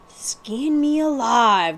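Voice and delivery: slow, stunned exclamation; in awe. Acted. The big outlaw gasps at the king's crest, slow and awed. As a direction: let it out slowly, amazed. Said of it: "declared the big man in awe".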